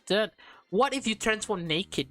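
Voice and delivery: ordinary delivery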